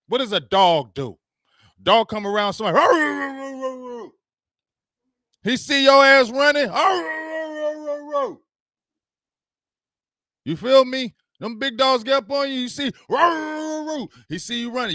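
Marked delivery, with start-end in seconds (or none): 2.8-4.1 high barking arr arr; hammed-up dog barking; mock dog bark. 6.7-8.4 high barking arr arr; hammed-up dog barking; mock dog bark. Dog barking as you run. 13.1-14.1 high barking arr arr; hammed-up dog barking; mock dog bark. Big dogs barking at you.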